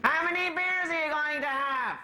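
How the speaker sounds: high mock Irish mammy; mammy probing, high and pointed; mother voice. Mother voiced asking son.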